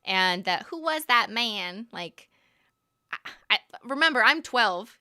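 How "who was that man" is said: lightly Southern, slowed-down quote of the line; a hint of the Southern film line; light Southern accent. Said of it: "trained by a dialect coach to speak like this".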